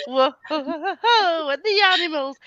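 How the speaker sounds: put-on slow, laughing sung line in a Yorkshire accent; a hammed-up Yorkshire singalong. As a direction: croon it in broad Yorkshire while laughing. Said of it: "in a proper Yorkshire accent as well".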